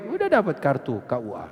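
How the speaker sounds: ordinary talk, nothing marked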